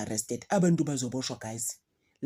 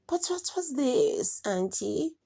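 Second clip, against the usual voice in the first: put-on much higher, slow childish question to auntie; imitating a niece; 10 semitones higher, much slower than usual. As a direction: stretch it like a kid asking auntie. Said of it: "bazobe bekhuluma kanje".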